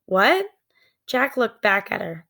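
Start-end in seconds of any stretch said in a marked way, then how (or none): 0.1-0.5 sharp higher What; surprised. Acted. Jack startled by Annie's words, surprised. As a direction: snap your head round in surprise.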